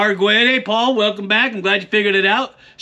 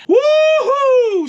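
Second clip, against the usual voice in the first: high whooping cheer; 4 dB louder than usual. Acted. Cowboy cheer.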